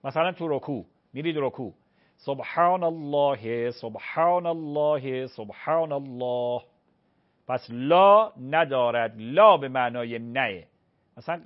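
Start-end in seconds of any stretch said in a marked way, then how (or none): 2.2-6.6 slow سبحان اللهِ, the h carried by a short i vowel; slow ruku recitation ending in لله-ِ; with kasra on h. Shown as a demonstration. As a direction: recite سبحان الله slowly, linking the final h with an i sound. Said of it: "یا حرکت میدید... کسره است".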